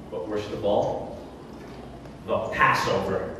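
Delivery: low, soft, foreboding announcement; ominous. Acted. Governor naming his worst trouble, low and ominous. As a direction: drop low and hushed for the dreaded name.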